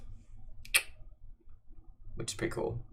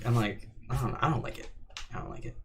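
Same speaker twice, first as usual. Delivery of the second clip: ordinary talk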